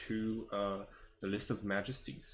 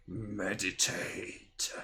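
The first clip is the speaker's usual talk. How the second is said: slow, raspy dragon rumble; 3 semitones lower, much slower than usual. Acted. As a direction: speak it like an ancient dragon. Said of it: "like, raspy dragon voice".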